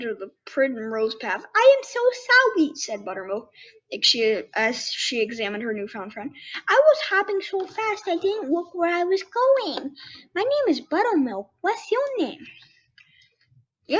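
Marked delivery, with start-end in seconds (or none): 1.5-2.7 squeaky little bunny asking, higher pitch. Acted. Bunny questions the skunk, high and curious. Buttermilk apologizes. 6.7-12.4 squeaky little bunny asking, higher pitch. Acted. Bunny questions the skunk, high and curious. Buttermilk introduces herself.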